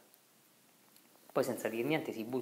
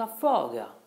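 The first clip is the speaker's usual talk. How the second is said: short shout, much louder; urgent shout; 4 semitones higher, 10 dB louder, slower than usual. Acted. Riccetto yelling at friends that the swallow drowns, urgent shout. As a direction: bark it out so they see the bird drowning. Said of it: "gridava il Riccetto".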